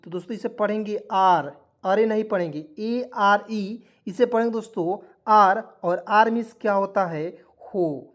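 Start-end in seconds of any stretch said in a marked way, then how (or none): 2.8-3.8 letters "A R E" spoken very slowly, one by one; slow spelling. Shown as a demonstration. Teacher spelling a word for students, very slow.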